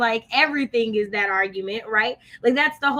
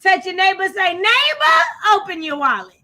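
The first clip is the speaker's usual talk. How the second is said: hammed-up pastor rallying the pews; playful church call for the offering; mock preacher; 6 semitones higher, 4 dB louder than usual. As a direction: call out the neighbor line high and loud. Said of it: "mock church offering call".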